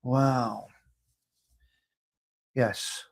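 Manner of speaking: ordinary talk, nothing marked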